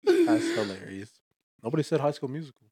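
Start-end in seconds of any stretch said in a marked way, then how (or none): 0.0-1.1 mock amused. Real, not acted. High slow reaction.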